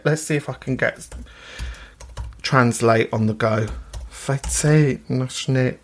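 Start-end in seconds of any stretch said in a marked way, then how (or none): 4.1-5.8 "Fête nationale" in a lightly put-on thick French accent, slowly. Shown as a demonstration. Host trying out the French name for listeners, mock French accent. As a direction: try the French like a Frenchman, a bit hammed. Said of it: "very thick French accent that I put on".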